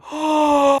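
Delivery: sharp high gasp of surprise; surprised gasp. Real, not acted. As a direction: gasp in amazement. Surprised gasp at build hack.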